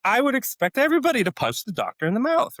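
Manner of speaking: high-pitched, exasperated ranting; heated indignation. Retold or imitated. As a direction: drive the absurd point home, worked up. Outraged punchline of the analogy.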